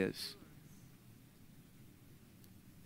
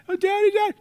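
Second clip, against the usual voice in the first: put-on very high, loud whiny child wail; imitating crying toddler; 6 dB louder, faster than usual. Preacher imitating his toddler son crying, very high whine. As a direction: mimic a little boy fake-crying for his dad. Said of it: "he can cry".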